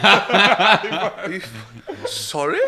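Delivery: high, loud feigned confusion with a laugh; mock puzzled. Driver being sworn at, mock innocent confusion. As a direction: play dumb at the swearing driver. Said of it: "and I'm like".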